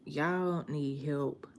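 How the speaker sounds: slow, flat put-down; dry, weary verdict. Retold or imitated. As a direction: say it slowly as if done with them. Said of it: "I be like".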